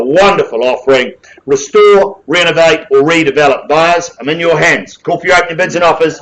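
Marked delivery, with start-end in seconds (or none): 1.5-6.2 brisk auction patter, rising on calls; auctioneer calling bids. Real, not acted. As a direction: drive the room like a live auctioneer.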